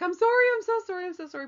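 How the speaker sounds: high, fast, tearful pleading; reliving a desperate apology to God. Retold or imitated. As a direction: beg forgiveness in a rush, voice up high. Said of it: "and I'm like".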